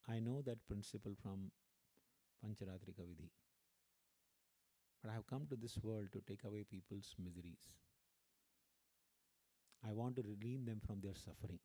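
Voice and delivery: low, soft, gentle assurance; calm, smiling reply. Retold or imitated. Guru explaining his mission to a worried devotee, gently. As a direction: answer quietly with settled compassion. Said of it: "Srila Prabhupada smiled".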